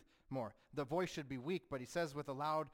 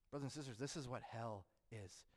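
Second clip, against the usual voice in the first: ordinary talk, nothing marked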